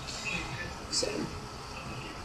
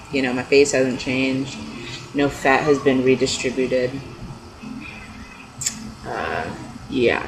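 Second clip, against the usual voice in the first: ordinary talk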